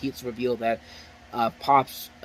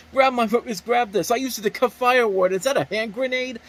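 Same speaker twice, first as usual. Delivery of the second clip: higher, louder mock dialogue; imitated movie chatter; 10 semitones higher, 6 dB louder than usual. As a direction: act out overheard movie characters, pitched up. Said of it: "you hear people talking about".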